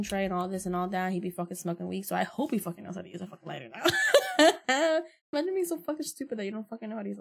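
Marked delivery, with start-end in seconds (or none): 3.9-5.1 loud, high laugh; a real laugh mocking her ex; burst of laughter. Real, not acted. Laughs at ex's lighter.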